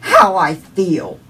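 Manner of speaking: very slow, loud furious cry; yelling at the top of lungs. Retold or imitated. Mother screaming her grief at God, slow and loud. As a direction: drag out every word, full volume. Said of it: "I was yelling to the top of my lungs".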